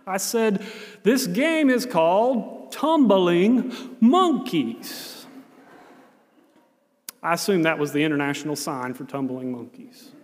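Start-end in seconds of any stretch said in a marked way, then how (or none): 1.0-5.3 miming slowly. Retold or imitated. Volunteer miming the game to the boy, very slow. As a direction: stretch every word as you act it out. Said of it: "So I tried to mime it".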